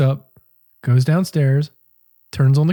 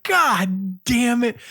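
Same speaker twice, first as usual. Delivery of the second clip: frustrated curse; 7 semitones higher, much slower than usual. Retold or imitated. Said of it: "So I was like".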